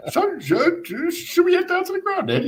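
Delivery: very high, loud, stammered suggestion; mock nervous squeak. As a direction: squeak it out like a rattled away fan. Acts out nervous suggestion among hostile fans.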